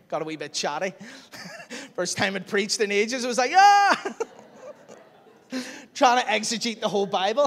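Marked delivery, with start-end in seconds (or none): 3.5-4.1 high loud mock shriek of overload; mock overwhelmed yell. Preacher mocking his own rushed first sermon, high yelp. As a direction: yelp like it all spills out.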